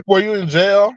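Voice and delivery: sarcastic rising question; mocking. Real, not acted. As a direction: tease it back at her. Mocking repeat with laugh.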